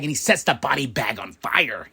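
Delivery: ordinary delivery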